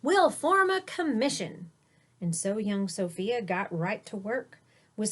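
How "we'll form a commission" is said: high and loud, eager; rally her on like a booming mayor; excited mayor. A narrator's reading. The mayor urges Sophia on, high and loud. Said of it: "cried the mayor".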